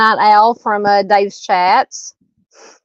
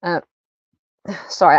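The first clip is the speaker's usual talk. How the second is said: hiccuping between words; breath caught by hiccups; faster than usual. Real, not acted. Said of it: "I have the hiccups".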